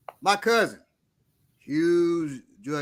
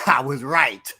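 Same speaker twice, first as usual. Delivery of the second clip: smug gloating; 2 semitones lower, 4 dB louder, slower than usual. Retold or imitated. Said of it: "Like that, right?".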